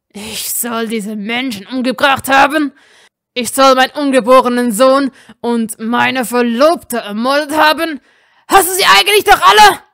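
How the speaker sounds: loud, climbing to a fast, high furious yell; building fury. A narrator's reading. Draco accused of killing his family, building fury. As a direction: let the rage rise to breaking.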